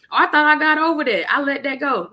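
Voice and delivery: high, loud mimicked self-assurance; mock protesting. Imitating people who claim they healed, mock protesting.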